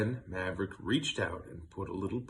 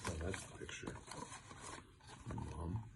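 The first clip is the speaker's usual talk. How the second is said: hushed aside; 5 semitones lower, 17 dB quieter than usual. Real, not acted. Reader softly pointing out the picture. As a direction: whisper the picture note to your listener.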